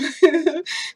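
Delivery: loud high laugh bursting out; sudden giggling fit; burst of laughter. Real, not acted. Laughing at poisoning memory.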